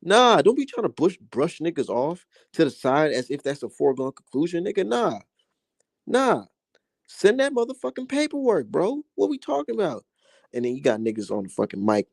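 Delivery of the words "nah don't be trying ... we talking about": high, heated callout of the coach; fired-up high-pitched rant. Real, not acted. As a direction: call out the coach's excuses, pitch way up.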